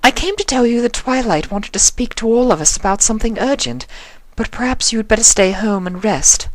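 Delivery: high, elegant lady voice; Rarity's voice. A narrator's reading. Voicing Rarity.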